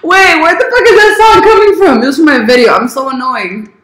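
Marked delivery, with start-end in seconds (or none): 0.0-2.0 high, loud mock bewilderment; retold confusion. Retelling hearing a song from own video, high baffled exclamation. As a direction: replay your baffled outburst, pitched up. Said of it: "I was like".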